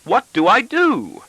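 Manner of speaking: wife pressing for an answer, shrill. A narrator's reading. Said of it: "my wife repeats shrilly".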